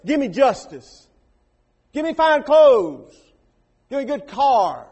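loud, slow, insistent demands; demanding. Retold or imitated. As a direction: lay out each demand like a claim. Rich man's imagined demands.

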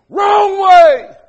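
"Wrong way" yelled high and loud like a warning sign; shouted warning. Retold or imitated. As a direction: bellow "Wrong way" at the driver. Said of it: "It'll tell you".

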